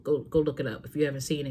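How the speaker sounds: normal talking voice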